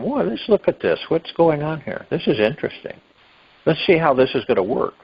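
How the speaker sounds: light, wondering "whoa" that starts high then settles; childlike curiosity. Retold or imitated. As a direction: play it like a curious child finding something new. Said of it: "that childlike curiosity".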